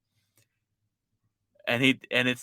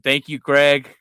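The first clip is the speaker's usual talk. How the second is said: loud shout-out; 3 semitones higher, 10 dB louder, slower than usual. Real, not acted. Shout-out thanks to Greg.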